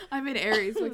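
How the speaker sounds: laughing through the words. Real, not acted. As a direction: giggle as you say it. Laughing joke about herself.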